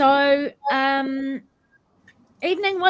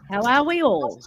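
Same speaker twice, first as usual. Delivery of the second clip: ordinary talk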